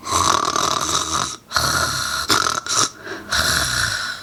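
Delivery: long, rumbling mock snores; storybook snoring sound effect; pretend snoring. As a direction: snore noisily as if fast asleep. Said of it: "Sounds of snoring fill the room".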